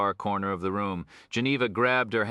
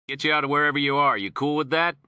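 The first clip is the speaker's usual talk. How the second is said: higher, louder, quicker street talk; streetwise cop girl; 8 semitones higher, 5 dB louder than usual. Acted. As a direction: sell it like a tough gang girl.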